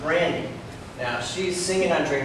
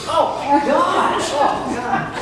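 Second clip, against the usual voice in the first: slow, high, louder oh my gosh; drawn-out disgust; 9 semitones higher, 4 dB louder, much slower than usual. Real, not acted. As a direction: stretch it out, appalled. Disgusted exclamation about Emu.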